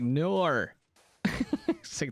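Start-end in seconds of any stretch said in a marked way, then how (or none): 0.0-0.7 "Nor." said as an Aussie would, with an R added to the end of no; mock Australian "Nor." with a tacked-on R; Aussie accent. Streamer mocking Aussies, who she loves, saying no in an Australian accent. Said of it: "and they're like".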